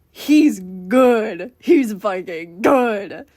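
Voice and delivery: loud, emphatic awe, drawn-out ending; amazed. Acted. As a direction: lean hard on each "good". Acting amazed onlookers at the show.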